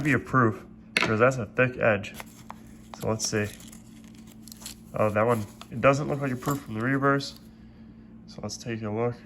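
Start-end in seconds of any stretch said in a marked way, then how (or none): none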